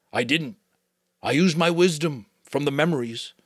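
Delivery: usual speaking voice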